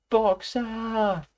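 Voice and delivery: high anguished call; terrible cry. A narrator's reading. Clover seeing Boxer at the van window, anguished cry. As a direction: cry out in horror. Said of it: "cried Clover in a terrible voice".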